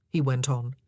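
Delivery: plain reading voice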